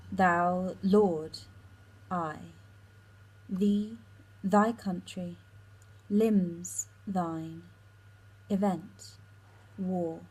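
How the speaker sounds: isolated words, each set apart by a pause; deliberate word-by-word read of a verse speech; nouns-only reading. Shown as a demonstration. Student working through Helena's speech for text class, nouns-only reading. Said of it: "just reading it through with the nouns".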